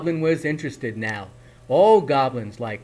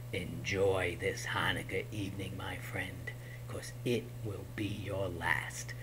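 menacing goblin king; 6 semitones lower, 7 dB quieter than usual. Acted. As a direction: whisper a deadly threat.

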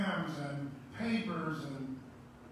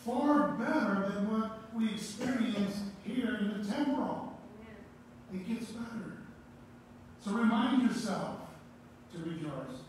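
raised, ringing pulpit voice; fervent preaching; 4 semitones higher, 3 dB louder than usual. Acted. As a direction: preach it out to the congregation, building. Preaching climax, exhorting congregation.

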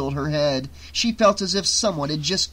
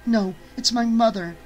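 distressed; 7 semitones higher than usual. A narrator's reading. Distressed Jess.

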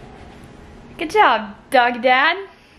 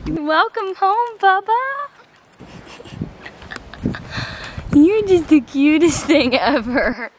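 talking to a puppy; 6 semitones higher than usual. Real, not acted. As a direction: stretch it out sweetly for the puppy. Greeting the puppy in baby talk.

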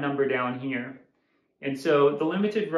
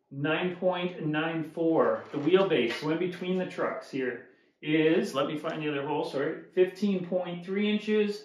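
ordinary delivery

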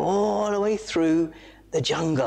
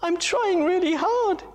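very high, strained little voice; high strained monkey voice; straining monkey; 13 semitones higher than usual. Acted. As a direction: push the words out as he struggles. Moto strained.

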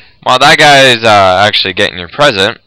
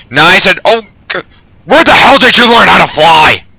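high, loud alarmed yelp; startled outburst; 7 semitones higher, 4 dB louder than usual. Real, not acted. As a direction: react to a near miss. Helicopter nearly hits him.

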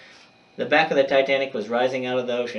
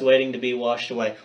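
plain reading voice

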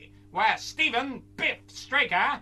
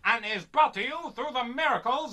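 put-on old radio announcer; 3 semitones higher, 4 dB louder than usual. Announcer opens the space serial for listeners, booming announcer read. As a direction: sell the thrills like a vintage radio herald.

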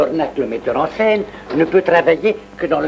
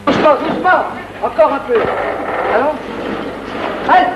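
coaxing call; 8 semitones higher, faster than usual. Acted. Coaxing someone along gently.

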